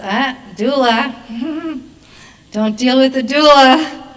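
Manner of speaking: slow, taunting devil with a snicker; little devil. Acted. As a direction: draw it out, building to a loud devilish warning. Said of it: "the little devil goes".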